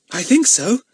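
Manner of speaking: put-on proud young detective; importantly. A narrator's reading. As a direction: answer as if you have big news. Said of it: "said Daisy importantly".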